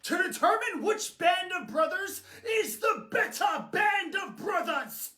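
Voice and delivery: high, dramatic British war speech; rally the troops in a British accent. Acted. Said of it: "talking with a British accent".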